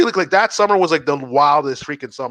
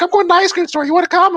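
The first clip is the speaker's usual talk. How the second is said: put-on lightly raised, higher girlish voice; girl's voice; 13 semitones higher, 4 dB louder than usual. Girl on the street inviting him, higher voice. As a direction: play the girl casually. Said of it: "She's like".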